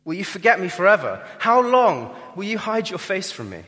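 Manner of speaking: loud, high, pleading lament; anguished cry. Acted. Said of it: "He's calling out to him in anguish".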